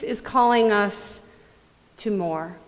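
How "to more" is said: usual speaking voice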